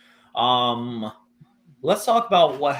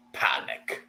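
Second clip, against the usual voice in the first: breathy whispered punchline; 10 semitones lower, 5 dB quieter than usual. Acted. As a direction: drop it to a whisper.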